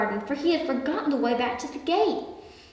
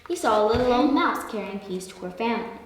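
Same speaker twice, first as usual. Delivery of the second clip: ordinary delivery, nothing marked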